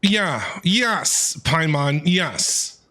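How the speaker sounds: ordinary talk, nothing marked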